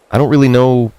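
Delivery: usual speaking voice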